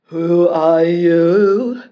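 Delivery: loud, slow monster voice; fierce monster roar. Acted. Monster confronting the prince on its island, loud slow roar. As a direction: roar it out of the bush. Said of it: "A fierce monster roared".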